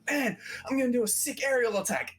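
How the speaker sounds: high-pitched mock battle bravado; eager boast. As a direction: pitch it up like an overeager attacker. Acting Bobby's eager battle boast.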